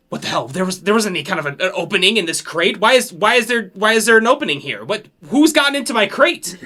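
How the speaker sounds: a sailor spiralling into alarm over his crate; freaking-out pirate. Acted. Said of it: "this pirate kinda freaking out".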